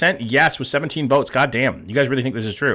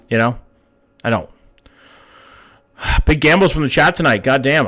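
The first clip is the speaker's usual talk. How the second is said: usual speaking voice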